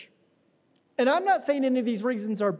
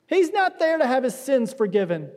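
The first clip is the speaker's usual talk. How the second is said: usual speaking voice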